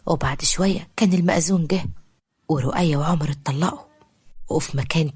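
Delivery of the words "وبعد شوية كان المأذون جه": usual speaking voice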